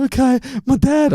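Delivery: put-on crying girl; mock crying. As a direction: mimic her whimpering reply. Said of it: "reading her phone and crying".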